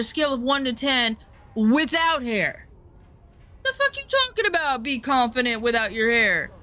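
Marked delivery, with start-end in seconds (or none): 3.6-4.8 1 incredulous outburst. Real, not acted. Exclaims in disbelief at the comment.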